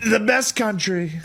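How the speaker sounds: rally Trump. Shown as a demonstration. Showing the friend Trump's slow rally line. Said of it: "speech Trump".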